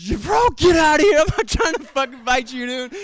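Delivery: high, loud panicked yell at the attacker; mock yell. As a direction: shove him off with your voice, high and loud. Himself yelling at attacker.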